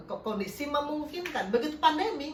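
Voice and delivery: usual speaking voice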